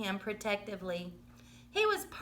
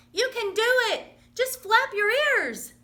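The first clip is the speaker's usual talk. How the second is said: encouraging shout; 10 semitones higher, 6 dB louder than usual. Acted. Mouse urging elephant to fly, high loud cheering. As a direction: cheer him on loudly. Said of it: "encouraged him".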